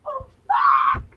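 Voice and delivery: high, loud startled cry; startled shout. Real, not acted. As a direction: yelp it in sudden shock. Sudden game mishap.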